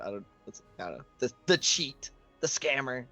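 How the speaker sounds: normal talking voice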